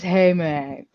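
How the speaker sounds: low, soft husky greeting; deep voice. Shown as a demonstration. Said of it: "did it sound like this?".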